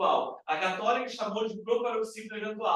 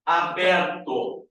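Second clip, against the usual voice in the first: "aberto" stretched slowly and louder, open é; drawn-out "aberto"; 5 dB louder, much slower than usual. Shown as a demonstration. Teacher stressing the term for the class. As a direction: lean on "aberto", holding the open vowel. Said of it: "O que é um ditongo aberto?".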